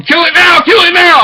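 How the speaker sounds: high, loud shout of kill it now; shouted order. Retold or imitated. Retelling people at Teppanyaki screaming orders at him, loud shout. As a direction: yell it like a mob.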